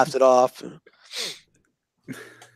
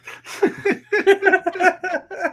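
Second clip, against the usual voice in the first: high burst of real laughter; high laugh at the filthy letters. Real, not acted.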